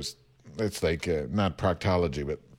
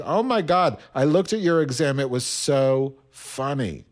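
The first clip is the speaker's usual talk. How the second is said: gushing amusement; 2 semitones higher than usual. Retold or imitated. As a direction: imitate an amused teacher, stretching the punchlines. Retold teacher gushing over exam.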